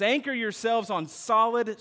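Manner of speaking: normal talking voice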